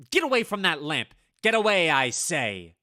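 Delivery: loud, fast swatting yell; shouting at moth. Acted. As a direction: drive it off the lamp. Chasing moth from lamp.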